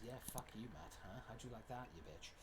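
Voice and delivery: quiet gloating. Real, not acted. Quiet gloating taunt at Matt.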